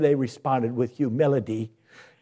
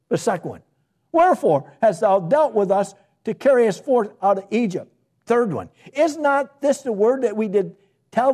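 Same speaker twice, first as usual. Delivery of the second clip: scripture reading; 9 semitones higher, 4 dB louder than usual. Acted. Rereads the murmuring questions.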